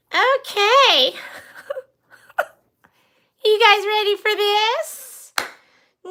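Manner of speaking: high, loud put-on character voice; excitable skit character. Skit character asking viewers if they're ready, excitable. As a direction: play it squeaky-high and drawn out.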